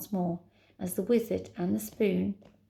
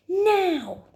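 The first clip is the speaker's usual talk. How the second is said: very high, loud drawn cry; urgent shout; 11 semitones higher, 6 dB louder than usual. Acted. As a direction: shout it with all your force. Heroine shouts while pushing.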